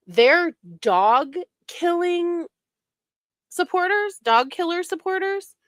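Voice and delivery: sarcastic outrage. Real, not acted.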